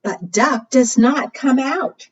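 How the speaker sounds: ordinary delivery, nothing marked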